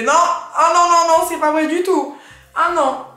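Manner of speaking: high, loud mock denial; loud protest. Retelling her reply to a boy who judged her hair, loud high denial. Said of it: "J'ai fait".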